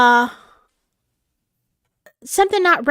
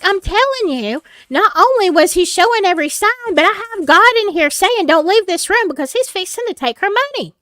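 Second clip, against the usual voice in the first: raised, higher urgent insistence; heated insisting to a disbelieving friend; insistent; 7 semitones higher, 5 dB louder than usual. Retold or imitated. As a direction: press the point hard, voice up. Insisting to the doubting pastor.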